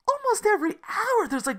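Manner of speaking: ordinary talk, nothing marked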